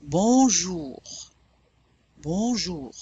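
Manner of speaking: "bonjour" with a nasal on and a soft j; «bonjour» with a nasal first vowel. Shown as a demonstration. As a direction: model "bonjour" with the vowel through the nose. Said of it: "drop the soft palate".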